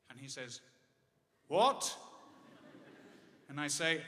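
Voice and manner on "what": high, loud, rising question; hard-of-hearing dad calling out; loud deaf "What?". Retold or imitated.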